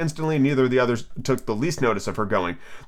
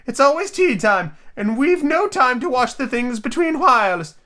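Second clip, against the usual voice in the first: high, slow, drawn-out lament; hammed-up weary complaint; sighing Hatter; 12 semitones higher, slower than usual. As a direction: stretch it out in plaintive high tones. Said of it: "said the Hatter with a sigh".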